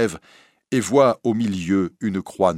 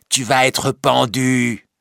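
slow, raised, drawn-out menace; lugubrious gloating; 5 semitones higher, 5 dB louder, slower than usual. Acted. Recluse to the captured girl, sinister gloating. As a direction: gloat darkly, stretching each word. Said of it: "le rire lugubre de l'étrange femme, qui lui dit".

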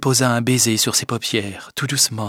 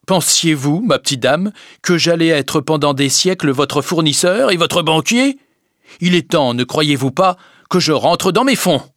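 higher, pointed lecturing; sarcastic creditor's reproach; indignant rebuke; 6 semitones higher, 4 dB louder than usual. A narrator's reading. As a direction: lecture a debtor with mounting impatience. Creditor scolding his debtor.

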